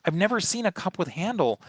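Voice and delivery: high, disbelieving retold thought; can't believe how ugly it is; incredulous. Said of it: "And I'm like".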